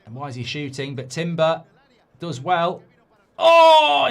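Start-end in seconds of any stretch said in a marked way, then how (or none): 3.4-4.1 loud, high burst of "Oh"; a fan leaping at a near chance; sudden exclamation; excited cry. Real, not acted. Shot flashes across goal.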